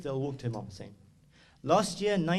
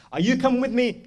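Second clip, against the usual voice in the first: high, raised ringing call; louder and higher, summoning someone; acted urgent call; 10 semitones higher, 9 dB louder than usual. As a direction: call out the question to each follower. Imam's call repeated, acted.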